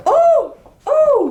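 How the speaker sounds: very high, loud squealing oh; excited squeal. Retold or imitated. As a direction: squeal with delight as he likes it. He liked her photo.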